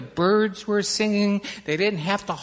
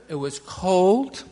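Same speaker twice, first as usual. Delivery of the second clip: plain reading voice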